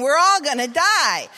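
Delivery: imitated hysterical outcry; screaming passenger. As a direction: wail it like a terrified passenger. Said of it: "she's now screaming".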